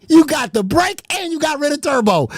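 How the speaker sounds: usual speaking voice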